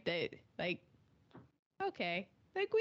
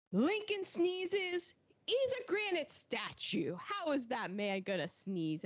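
incredulous; 6 semitones higher than usual. Retold or imitated. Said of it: "And Ernest is like".

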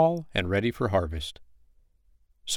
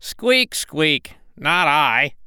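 high squeaky rat refusing; rat's high squeak and quick no; 6 semitones higher, 4 dB louder, much slower than usual. Acted. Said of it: "the rat said".

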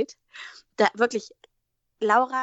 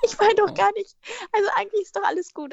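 hammed-up high voice claiming she isn't crying; mock tearful denial; 11 semitones higher than usual. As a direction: squeak it out, pretending all is fine. Mock voice, pretending not to cry.

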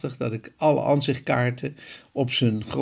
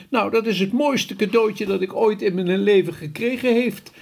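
much higher delighted giant voice; overjoyed exclamation; 8 semitones higher than usual. A narrator's reading. The grateful giant exclaims to Sophie, high and overjoyed. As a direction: gush with happiness. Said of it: "riep de G V R".

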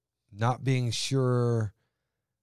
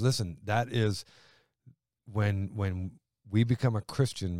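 ordinary delivery

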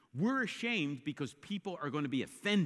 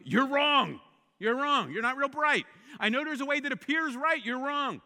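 louder, higher, punching wrong; loud verdict; 8 semitones higher, 8 dB louder than usual. Pretend. Preacher voicing the gospel's blunt verdict, loud. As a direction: hammer it repeatedly.

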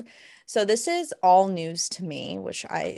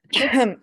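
loud sharp throat clear; 5 semitones higher, 7 dB louder than usual. Real, not acted. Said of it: "Sorry, that was a cough".

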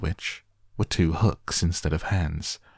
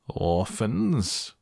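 scratchy, drawn-out villain's call, higher; 4 semitones higher than usual. A narrator's reading. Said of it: "called out in his scratchy voice".

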